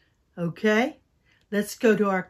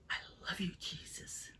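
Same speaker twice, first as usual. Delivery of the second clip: soft whispered love; 4 semitones lower, 19 dB quieter than usual. Shown as a demonstration. Teacher whispers the phrase for the kids. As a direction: whisper it tenderly. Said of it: "Can you do".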